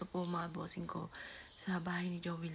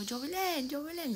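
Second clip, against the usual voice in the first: calling the name out, higher, as if from another room; retold calling out; 9 semitones higher, 4 dB louder than usual. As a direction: call her name twice, pitched up. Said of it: "tatlong beses daw akong sumigaw". (tinatawag nang pasigaw si Jovelyn)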